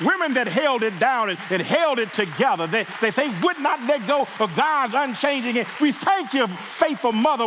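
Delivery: fervent preacher's climax; preaching at full force. Acted. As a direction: lift the church into praise. Rising praise to mothers.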